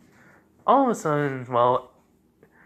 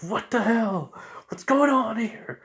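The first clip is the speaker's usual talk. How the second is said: startled shout, pitched way up; in-character outburst; 11 semitones higher, 4 dB louder than usual. Acted. Narrator acting out Zabuza caught in wires, startled outburst. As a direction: blurt it high and loud, baffled. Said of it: "Sorry, I'm just getting to the character a little".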